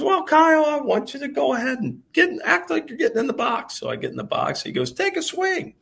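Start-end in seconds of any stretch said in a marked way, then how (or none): 0.0-3.8 much higher, louder mock voice of the mentor; a hammed-up high mentor voice coaching a player; imitating Ken's voice. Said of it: "Ken's got this great voice". 4.9-5.7 a hammed-up high mentor voice coaching a player; imitating Ken's voice. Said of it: "Ken's got this great voice".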